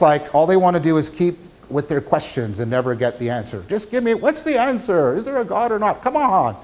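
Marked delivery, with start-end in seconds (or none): 3.7-6.6 impatient student. Retold or imitated. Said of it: "find philosophers frustrating".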